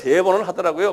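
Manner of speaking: ordinary talk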